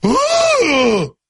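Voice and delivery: high, drawn-out "ooh" of alarm; startled gasp. Retold or imitated. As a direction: imitate a shocked gasp. Retelling a startled friend's reaction.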